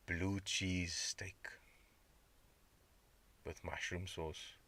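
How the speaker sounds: slow, soft whisper; longing whisper for steak; craving whisper. Real, not acted. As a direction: breathe it out like a craving. Craving food, drooling.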